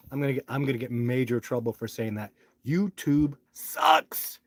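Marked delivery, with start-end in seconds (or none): 2.6-4.4 drawn-out disgust. Real, not acted. Ranting about YouTube.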